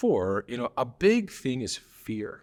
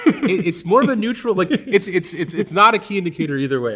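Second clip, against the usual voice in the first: laughing; 7 semitones higher, 8 dB louder than usual. Real, not acted. Laughing while correcting the other.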